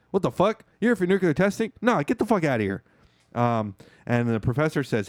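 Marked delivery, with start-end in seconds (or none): 0.1-2.8 higher, pissed-off retold rant; mock angry. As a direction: act out the angry boss. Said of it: "And he gets all pissed".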